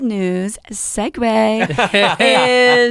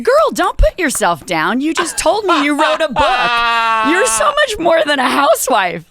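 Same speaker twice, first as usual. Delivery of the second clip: higher and louder, insisting she's more than that; emphatic encouragement; 7 semitones higher, 6 dB louder than usual. Retold or imitated. Telling the new acquaintance not to sell herself short, emphatic and louder. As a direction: hype her up, half laughing. Reassuring the woman she'd met.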